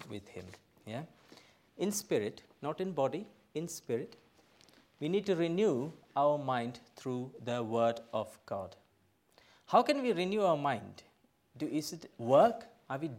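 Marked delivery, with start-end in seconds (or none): none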